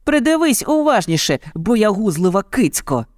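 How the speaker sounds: put-on high-pitched taunting child; boy's acted shout. A narrator's reading. Boy taunts the cowardly tiger, high mild shout. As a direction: shout high at moderate volume. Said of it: "крикнув Мауглі".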